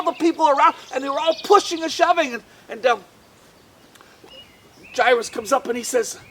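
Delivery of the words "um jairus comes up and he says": plain reading voice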